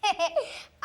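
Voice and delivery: teasing giggle; short mocking laugh. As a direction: snicker at dad's expense. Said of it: "क्यों हंस रहे हो?".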